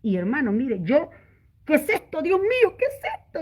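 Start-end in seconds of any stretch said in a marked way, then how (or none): 1.7-3.4 asombro recordado. Retold or imitated. Su asombro al recibir revelación.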